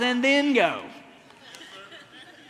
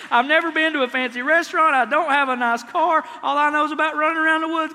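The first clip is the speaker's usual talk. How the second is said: rising, louder whining complaint to God; whiny pouting prayer; 6 semitones higher, 5 dB louder than usual. Retold or imitated. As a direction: build from a sulk to a high wail. Preacher voices pouting man to God.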